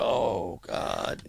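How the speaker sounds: quiet mock despair, said to himself; retold dismay. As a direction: let it drop away to a soft, voiceless end. His retold dismayed reaction.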